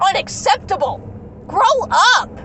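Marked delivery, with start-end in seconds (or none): 1.5-2.3 blunt and sharp, a touch louder; fed-up retort; angry command. Real, not acted. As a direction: snap it at them like a scolding. Ranting at parents, sharp command.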